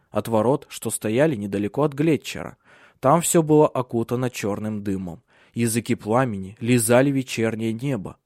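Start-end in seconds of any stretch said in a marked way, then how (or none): none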